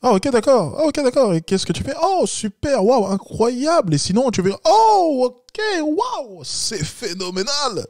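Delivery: very high-pitched, over-excited mimicry of the client; mock gushing exclamations. Coach parodies the client's reactions to the woman, gushing in falsetto. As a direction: squeal exaggerated amazement, stretching the big words. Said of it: "les petites exclamations liées à sa voix".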